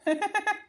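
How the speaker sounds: short high laugh; scoffing laugh. Acted. Son laughing at his mother's complaint, scoffing laugh. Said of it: "इसमें हंसने की तो कोई बात नहीं है".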